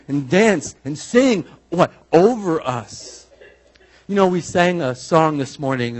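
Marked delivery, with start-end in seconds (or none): none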